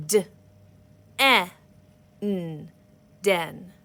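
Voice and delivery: "S, a, p, sap" sound by sound, then blended; one finger per sound. Shown as a demonstration. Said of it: "show you how to break up your words".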